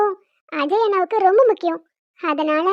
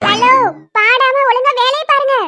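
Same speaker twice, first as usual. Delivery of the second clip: loud, higher, drawn-out reprimand; scolding call; 4 semitones higher, 6 dB louder, slower than usual. Acted. Wife telling singing husband to work, scolding call. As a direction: call out slowly and loudly to cut him off.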